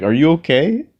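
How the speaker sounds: high, loud mock concern from the car window; driver asking. Driver leaning out to a walker, mock concerned asking. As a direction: ask it up high like a nosy driver.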